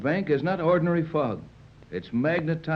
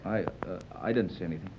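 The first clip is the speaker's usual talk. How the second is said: much softer, lower, hesitant; flustered murmur; 7 semitones lower, 8 dB quieter, faster than usual. Acted. Tex embarrassed after calling her darling, quietly flustered. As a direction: backpedal shyly.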